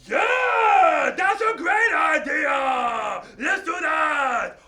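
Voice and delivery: shouted high and loud, slow; excited shout. Acted. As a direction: cheer the idea at full voice. Character cheering an idea.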